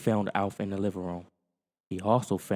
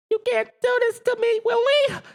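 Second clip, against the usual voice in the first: very high, loud alien voice begging; pleading Alf; 8 dB louder than usual. Acted. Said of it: "Alf pleaded".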